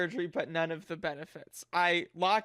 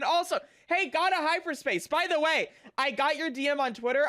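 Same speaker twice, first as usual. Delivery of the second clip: excited greeting; 8 semitones higher, 3 dB louder than usual. Real, not acted.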